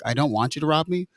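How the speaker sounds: ordinary talk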